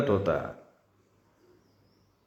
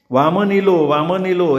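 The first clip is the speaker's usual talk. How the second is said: loud, higher call; 6 semitones higher, 3 dB louder than usual. A narrator's reading. Villagers call out that Vaman has come, loud. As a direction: announce him to the crowd. Crowd calling out his arrival.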